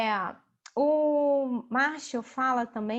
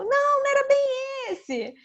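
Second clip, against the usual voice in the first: put-on much higher, louder kid voice; spontaneous child; 12 semitones higher, 5 dB louder than usual. Child disappointed by the present, high-pitched protest. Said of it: "Criança autêntica".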